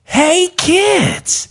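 put-on high bright children's host voice; chirpy tour voice for children; cheery kids' guide. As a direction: sell it to the kids, sing-song. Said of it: "it's like".

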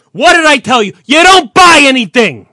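loud high yell; furious shout. Acted. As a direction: scream it in his face. Acted boss shouting.

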